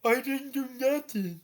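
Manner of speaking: high, soft, pleading denial; protesting goblin. Retold or imitated. Goblin denying he touched her, high soft protest. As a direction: whine your innocence. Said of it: "just going".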